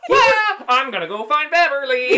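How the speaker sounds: high, loud laughing cry; excited shout. Acted. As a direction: shout it out like a cartoon creature. Voicing heart leaping out.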